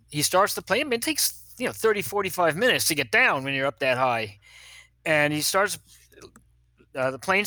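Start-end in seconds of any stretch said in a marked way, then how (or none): none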